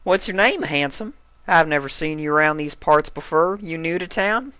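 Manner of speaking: lightly voiced flirting country mare; flirty Applejack. A narrator's reading. Applejack flirting with the stallion, light country voice. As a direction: sweet-talk the handsome stranger.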